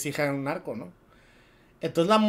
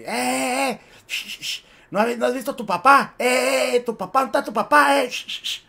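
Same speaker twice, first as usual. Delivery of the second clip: thugs calling out; 6 semitones higher than usual. Acted. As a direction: jeer and call after her like street thugs. Imita a matones acosando a chica. (matones gritándole a la chica, voz algo más aguda)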